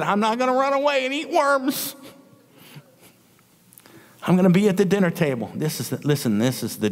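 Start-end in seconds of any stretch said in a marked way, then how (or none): 0.0-2.0 put-on self-pitying child. Preacher as child complaining to mom, high self-pitying whine. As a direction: whine it high like a pouting kid. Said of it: "And I said".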